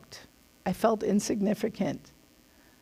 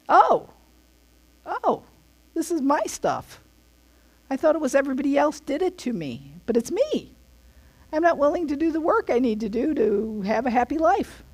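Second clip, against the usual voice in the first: higher, sudden dawning of realization; mock realization; 6 semitones higher than usual. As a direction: play someone suddenly seeing their own fault. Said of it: "it's like you see it".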